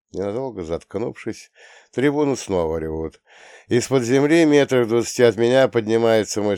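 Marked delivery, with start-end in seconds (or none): none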